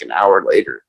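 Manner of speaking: ordinary talk, nothing marked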